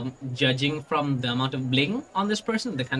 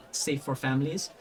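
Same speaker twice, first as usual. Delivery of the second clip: normal talking voice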